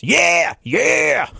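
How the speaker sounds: high shouted metal yeah. Retold or imitated. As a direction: bark it like a metal frontman. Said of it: "[name] doing an impersonation of [name]".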